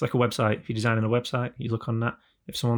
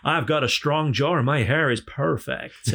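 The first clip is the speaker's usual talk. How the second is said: slow, puffed-up mock American anchor; American news anchor; 3 semitones higher, 4 dB louder, slower than usual. Anchor boasting of his looks, mock American voice. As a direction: drag it out like a vain US newscaster. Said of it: "in America, it's more like".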